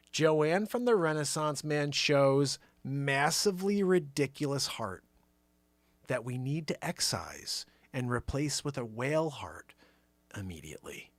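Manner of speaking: slow, fading into a whisper; slow reading. Shown as a demonstration. Host slowly reading the fundraiser title. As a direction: draw it out then drop to a whisper. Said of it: "when I say it slow".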